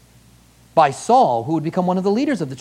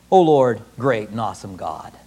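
reverent prayer; 7 semitones lower than usual. Retold or imitated. Voicing Daniel's prayer.

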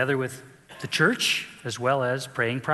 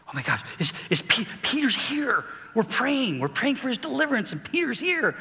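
put-on high, breathless excited girl; excited Rhoda; 11 semitones higher than usual. Rhoda bursting in on the prayer meeting, high and excited. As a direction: squeal it out in disbelief. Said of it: "She's all excited".

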